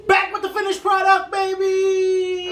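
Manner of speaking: high, loud hyped shout; excited shout. Real, not acted. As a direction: burst back in all hyped up. Returns hyped after the break.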